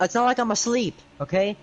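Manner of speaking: usual speaking voice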